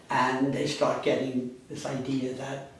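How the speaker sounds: ordinary delivery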